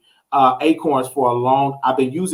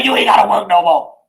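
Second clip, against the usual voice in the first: proud shout to mama; 4 semitones higher, 3 dB louder than usual. Retold or imitated. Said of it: "I say".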